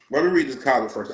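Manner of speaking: usual speaking voice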